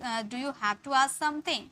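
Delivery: very slow, word by word; slow dictation. Shown as a demonstration. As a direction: dictate slowly. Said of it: "Do you ask". (धीमा उच्चारण)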